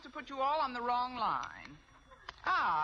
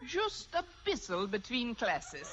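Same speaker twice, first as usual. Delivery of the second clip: lightly hammed German, half laughing; fake German accent. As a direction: play the reformed drinker in German accent. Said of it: "If I can do a decent German accent".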